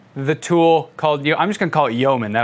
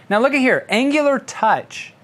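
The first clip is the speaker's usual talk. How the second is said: excited; 6 semitones higher than usual. Real, not acted. Spots exciting library option.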